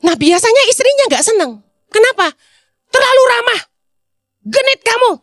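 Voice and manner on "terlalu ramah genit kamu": a jealous wife's mock scolding, pitched up. Wife mock-scolds her too-friendly husband, higher and sharp. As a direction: tease him for being too friendly, half-mock.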